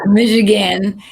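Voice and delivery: loud, playful Michigan accent. Shown as a demonstration. Guest shows off her home state, loud Michigan accent. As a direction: lean hard into the Michigan vowels. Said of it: "accent 'cause I got that going on".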